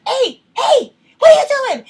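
high, loud call to stop someone; re-enacted shout. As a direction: yell it as if confronting muggers. Retold yelling at street robbers.

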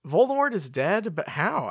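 mildly hammed-up astonishment; astonished question. A narrator's reading. Harry learning that Voldemort is dead, astonished.

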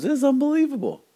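very high, loud put-on amazement; astonished. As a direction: squeal it like an overawed customer. Customer amazed by apple's taste.

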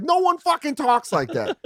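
high slow exasperated complaint; high drawn-out exasperation. Retold or imitated. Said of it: "you're like".

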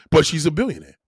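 usual speaking voice

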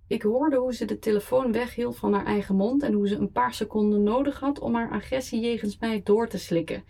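ordinary delivery, nothing marked

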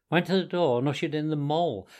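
ordinary delivery, nothing marked

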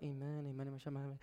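hushed, rapid-fire repeated amens; under breath. Retold or imitated. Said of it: "Under my breath".

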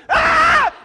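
high, loud mock witch shriek; witch scream. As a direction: leap out and shriek at the passer-by. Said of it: "The witch does it".

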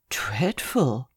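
whispered, shocked echo of the word; stunned whisper. Acted. Lawyer whispering the secretary's word back, aghast. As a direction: breathe "Dreadful" back in horror. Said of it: "I repeated".

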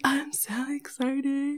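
slow, hushed squeal without voice; excited whisper. Retold or imitated. Retelling getting an extra leaf to feed, excited whisper. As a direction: whisper it as if bursting inside.

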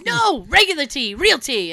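put-on emphatic old man, loud; Tony. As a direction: imitate an exasperated grandfather correcting. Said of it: "He goes".

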